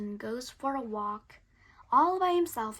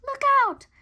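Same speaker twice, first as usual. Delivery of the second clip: loud, high warning shout; loud high cry of alarm; 12 dB louder than usual. Acted. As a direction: yell a warning.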